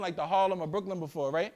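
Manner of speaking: usual speaking voice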